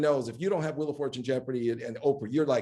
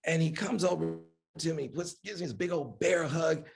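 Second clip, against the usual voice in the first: normal talking voice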